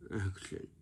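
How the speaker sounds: soft, lifeless "Action."; lazy action. Shown as a demonstration. Director giving a half-hearted "Action.". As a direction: mumble "Action." with no effort. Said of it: "you sound like this".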